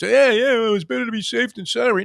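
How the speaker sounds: high, loud self-justifying protest; mock rationalizing. Homeowner excusing pointless shutter work, rationalizing loudly. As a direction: talk yourself into it, pitched right up. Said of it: "you would try to rationalize".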